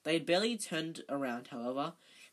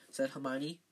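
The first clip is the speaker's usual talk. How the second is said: ordinary delivery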